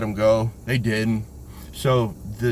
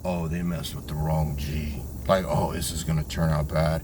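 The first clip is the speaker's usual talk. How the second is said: much lower, quieter knowing voice; imitating the praying man as he tells him what he saw; ominous bystander; 8 semitones lower, 6 dB quieter than usual. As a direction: drop it low and soft like a grim warning. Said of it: "he told me later".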